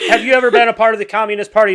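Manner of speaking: hammed-up congressional grilling; mock senator grilling. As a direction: rattle it off like a pushy old senator. Mocking senator's hearing question.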